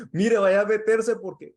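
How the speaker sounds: alarmed call to intervene; retold alarm. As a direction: urge him to step in before fists fly.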